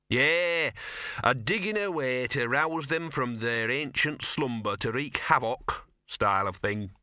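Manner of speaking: know-all dog relishing dark theories; talking dog, lurid gossip. Acted. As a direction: spin it out as an opinionated little dog.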